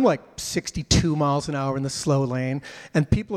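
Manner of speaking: usual speaking voice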